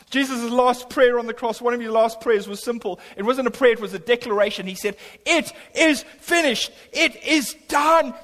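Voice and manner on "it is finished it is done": slow, high proclaimed shout; triumphant shout. Acted. Jesus declaring from the cross, slow ringing shout. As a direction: cry it out as a final declaration.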